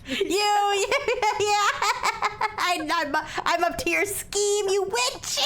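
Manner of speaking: giggling through the words; laughing. Real, not acted. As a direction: laugh while calling her a witch. Laughing accusation of scheming.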